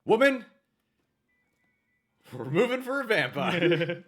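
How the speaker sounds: high, loud, slow proclamation; mock husband shout. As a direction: ham it up to the wife. Mock husband declaring loudly.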